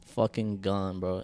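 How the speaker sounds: usual speaking voice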